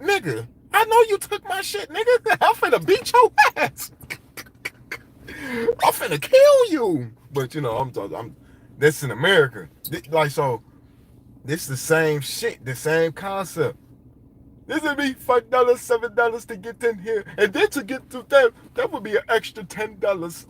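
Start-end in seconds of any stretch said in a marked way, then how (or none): 0.0-7.1 much higher, louder laughing mock threat; mock angry victim. Robbed man threatening the thief, very high laughing mock rage. 14.7-20.5 price it out like a scheming ticket seller; mock hustler. Imitating Egyptian site hustlers charging tourists, higher mock voice.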